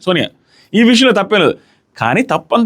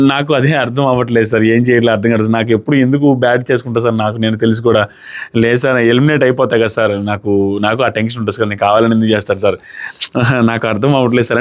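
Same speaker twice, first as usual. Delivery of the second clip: lower, confused pleading to the host; rattled contestant imitation; 8 semitones lower than usual. Imitating a flustered housemate pleading with the host, lower and confused. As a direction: mimic a player who feels he is losing his mind. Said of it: "psych psych అయిపోతున్నట్టుగా మాట్లాడుతున్నాడు".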